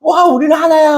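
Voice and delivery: mock triumphant cheer of unity; joyful cheer. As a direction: shout it out as a happy group finale. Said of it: "그리고".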